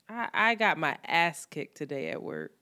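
ordinary talk, nothing marked

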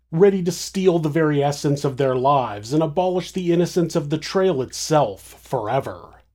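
plain reading voice

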